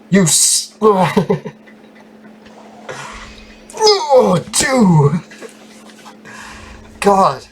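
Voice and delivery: high, pained whine at bad rolls; anguished whining. Real, not acted. Gamer begging for better dice rolls, high pained whine. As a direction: moan like the game is torturing you.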